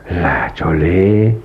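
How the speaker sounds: muy lento y grave, con incredulidad; incrédulo. Acted. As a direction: arrastra el nombre como quien no lo cree. Duda de la conquista presumida. (pregunta incrédula y alargada)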